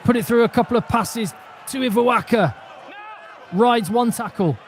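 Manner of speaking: loud, excited live match commentary; play-by-play. Real, not acted. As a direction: call the action at full pitch.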